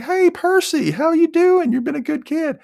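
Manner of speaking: high, bright-eyed Santa greeting; jolly Santa. Retold or imitated. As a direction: play Santa cheerfully welcoming the kid. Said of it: "Santa sees them and he's like".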